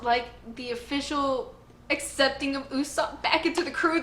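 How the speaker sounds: normal talking voice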